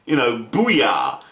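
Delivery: slow drawn-out mock booyah; Cyborg's triumphant booyah, lightly imitated; Cyborg catchphrase. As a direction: drag out "booyah" lazily. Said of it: "Cyborg just says".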